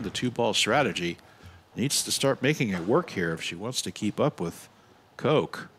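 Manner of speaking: ordinary delivery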